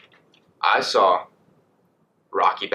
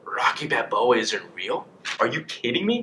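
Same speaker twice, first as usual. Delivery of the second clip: drawn-out, rising wail of disbelief; hammed-up heartbroken question; mock tearful disbelief; 3 semitones higher, slower than usual. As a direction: play a crushed kid, slow then pitching up. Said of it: "bawling my eyes out".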